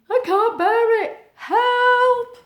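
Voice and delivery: high, loud, slow wail for help; distressed cry for help. Acted. Voicing a miserable character in the story, a high loud wail. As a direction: plead like someone who can't bear it. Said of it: "Somebody's saying".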